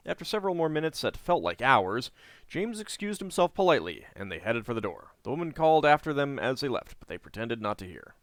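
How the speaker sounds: steady storybook read-aloud; story narration. A narrator's reading. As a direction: read the quest text like a novel. Reading the game's story text.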